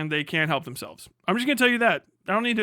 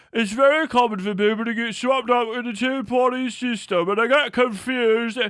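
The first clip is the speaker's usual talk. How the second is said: higher, louder put-on posh British voice; mock British pundit; 5 semitones higher, 5 dB louder than usual. Streamer mocking British press on the two-party system, put-on British voice. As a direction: sneer it like a smug British commentator.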